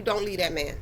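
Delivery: a hint of an urging granny. Granny telling her granddaughter to stay, lightly voiced. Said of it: "you can hear your granny voice tell you".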